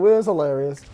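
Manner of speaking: ordinary delivery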